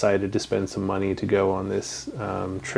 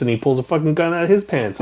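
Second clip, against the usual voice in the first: ordinary talk, nothing marked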